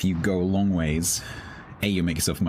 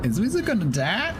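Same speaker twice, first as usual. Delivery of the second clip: high panicky squeal; panicked. Real, not acted. Panicked, pitch far up.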